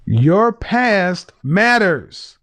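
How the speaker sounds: very slow, stressing each word; slow emphatic. Real, not acted. Host slowly laying down the point to the caller. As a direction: spell it out word by word.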